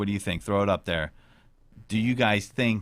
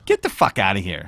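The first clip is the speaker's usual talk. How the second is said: mock outrage; 7 dB louder than usual. Mock outrage at sandwich pick.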